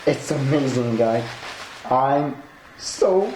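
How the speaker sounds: ordinary talk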